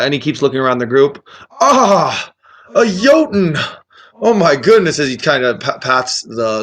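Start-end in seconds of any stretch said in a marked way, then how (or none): 1.6-5.0 put-on amazed old wizard, pitched high; dotty old man delighted by his discovery; loopy friendly wizard. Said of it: "a little loopy friendly".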